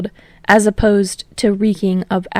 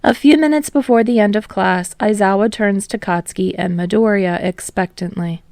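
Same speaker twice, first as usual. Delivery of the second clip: ordinary delivery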